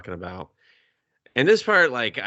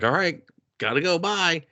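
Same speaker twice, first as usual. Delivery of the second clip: quick, higher breezy brush-off; hasty goodbye; 8 semitones higher than usual. Retold or imitated. Heroine ditching Larson, fast and higher. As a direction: rattle it off and leave.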